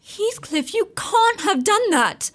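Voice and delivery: high, slow, stricken plea; appalled she can't believe it; horrified protest. Acted. Wife dismayed at his revenge.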